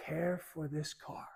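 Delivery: near-whispered, earnest counsel; hushed advice. Acted. Dad advising his lad about his car, hushed. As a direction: drop right down to a whisper for emphasis. Dad's advice on cars, acted.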